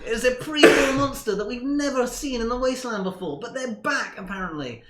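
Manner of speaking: high, building to loud eager nerd; nerdy voice. Acted. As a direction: lecture with rising excitement. Milton explains vampires.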